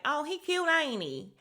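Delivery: loud, high-pitched imitated reply; hammed-up high squeal of a comeback; mock gushing retort. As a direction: toss it back high and loud. Imitates Stormy's loud reply to appeal.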